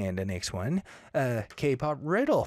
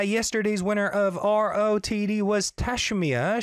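normal talking voice

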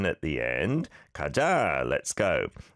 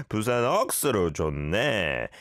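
final 네 raised high, then dropping; 4 semitones higher, much faster than usual. Shown as a demonstration. Modelling for a listener from Trinidad, high lifted 네. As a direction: swing 네 upward in Busan style. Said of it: "try and go up at the end".

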